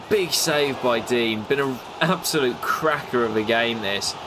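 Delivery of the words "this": normal talking voice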